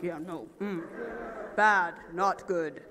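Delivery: low, soft and slow; mock pissed-off grumble. Faking anger for the teammates, low slow grumble. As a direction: grumble like you're annoyed. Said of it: "looks pissed off".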